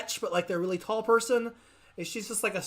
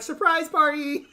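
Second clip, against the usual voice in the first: mock surprise cheer; 8 semitones higher, 5 dB louder than usual. Imagined player bursting in.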